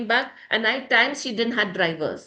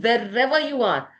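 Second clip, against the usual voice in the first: ordinary talk, nothing marked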